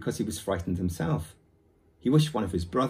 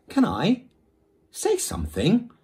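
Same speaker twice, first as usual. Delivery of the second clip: put-on higher, louder impatient boy; 11 semitones higher, 6 dB louder than usual. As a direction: cut in impatiently. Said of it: "said Omri impatiently".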